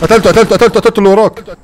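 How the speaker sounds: loud, high victory yell; excited shout. Real, not acted. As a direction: yell it out after the kill. Shouting kill in firefight.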